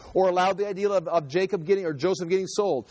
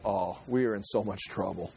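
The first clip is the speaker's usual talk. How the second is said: much lower and softer, a scared hush; hushed dread; 8 semitones lower, 7 dB quieter than usual. Acted. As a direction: breathe it out as if doom has just landed. Brothers look at each other.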